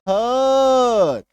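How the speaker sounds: हर sounded out clearly; model her. Shown as a demonstration. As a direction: say हर clearly for the class. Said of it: "बोल के देखो".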